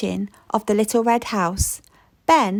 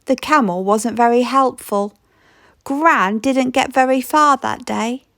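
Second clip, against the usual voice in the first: ordinary delivery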